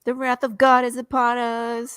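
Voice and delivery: put-on drunk ranting. Drunk Dwayne to the bar, raving about God's wrath. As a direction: rant like a drunk prophet of doom. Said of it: "he's just spouting nonsense".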